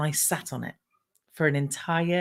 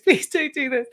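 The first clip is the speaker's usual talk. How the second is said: laughing plea; 8 semitones higher than usual. Retold or imitated. Dreading the follow-up question.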